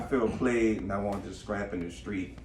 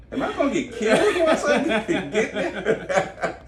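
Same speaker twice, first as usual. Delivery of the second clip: put-on high, loud laughing Russian accent; 12 semitones higher, 7 dB louder than usual. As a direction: squeal it in a joke Russian accent. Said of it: "every time we do a Russian accent".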